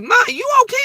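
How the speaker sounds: put-on a high worried call from the kids; the kids checking on mom, calling high and loud.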